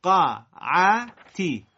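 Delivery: وقعتی slow and loud, each syllable apart. Shown as a demonstration. Teacher breaks وقعتی into syllables for students to repeat slowly. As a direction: separate every syllable of وقعتی carefully. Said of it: "حالا سر هم".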